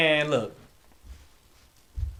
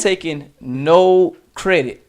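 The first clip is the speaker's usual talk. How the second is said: emphatic; 4 semitones higher, 9 dB louder, much slower than usual. Real, not acted. Emphatic sports argument.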